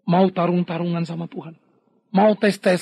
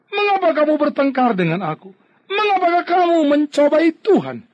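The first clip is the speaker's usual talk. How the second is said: high, loud rebuke of the grumbling people; rebuking Moses; 9 semitones higher, 6 dB louder than usual. Acted. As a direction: Moses scolding them, rising and forceful. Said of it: "Musa berkata kepada mereka". (Musa menegur umat dengan suara tinggi dan keras)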